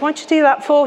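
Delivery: blunt, challenging question pitched high; Scouse directness. Retold or imitated. At a rainy party, questioning cousin's friend with Scouse directness. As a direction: fire the question straight back at her. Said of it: "You know, the Scouse directness".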